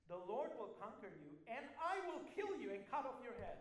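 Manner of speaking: loud, rising defiant declaration; David's battle cry. Acted. David threatens Goliath with a loud battle cry. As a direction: shout it straight at the giant.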